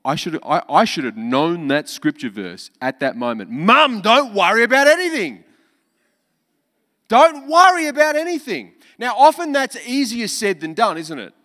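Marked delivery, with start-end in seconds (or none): 3.5-5.4 emphatic retort. Pretend. Imagined retort to mum. 7.1-11.2 loud, high, emphatic preacher; fiery sermon delivery; preaching at full force. Acted.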